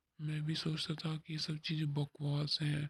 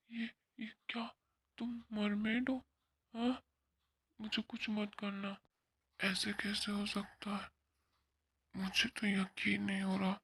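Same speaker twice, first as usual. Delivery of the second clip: frightened; 6 semitones higher than usual. Acted. Frightened by the mermaid.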